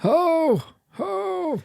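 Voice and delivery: put-on high old Santa ho ho; old Santa laugh. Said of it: "Santa age?".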